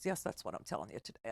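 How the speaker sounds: lightly voiced stubborn guy; mumbled mock reply. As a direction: throw it away like a sulky man. Voicing the guy's stubborn reply.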